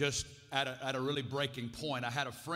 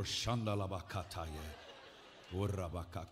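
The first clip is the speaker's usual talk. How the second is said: lower, imitating a mom praying in tongues; mock tongues; 8 semitones lower, 4 dB quieter than usual. Mom praying in tongues over Corey at the door, imitated. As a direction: mimic her babbling prayer at the door. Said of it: "She'd be waiting at the door".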